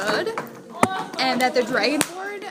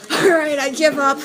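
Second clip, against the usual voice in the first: defeated Dragonborn; 2 semitones higher, 6 dB louder than usual. Acted. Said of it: "the Dragonborn says".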